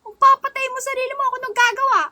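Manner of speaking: high, loud sweet-angry scolding; mock angry Ilonggo. Streamer acts an angry Ilonggo scene, high and loud. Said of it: "gagawa ko ng scenario na parang galit ako".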